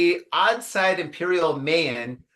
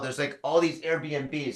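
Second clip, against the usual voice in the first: ordinary delivery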